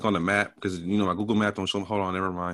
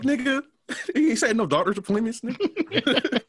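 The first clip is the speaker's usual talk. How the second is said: very high, cracking up through the words; laughing mock retort; 14 semitones higher than usual. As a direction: squeal it while laughing at the group chat. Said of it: "I'm like".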